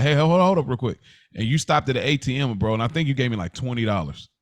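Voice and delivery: ordinary delivery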